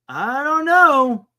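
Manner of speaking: slow, stretched-out I don't know; drawn-out doubtful. Real, not acted. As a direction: draw it out, slowly puzzled. Unsure about Dodgers falling.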